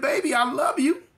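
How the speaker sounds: high and tearful; crying aunt. Retold or imitated. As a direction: say it through tears.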